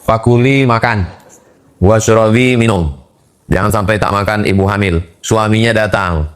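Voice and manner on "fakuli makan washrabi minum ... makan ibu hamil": usual speaking voice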